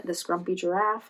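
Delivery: usual speaking voice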